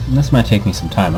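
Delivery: usual speaking voice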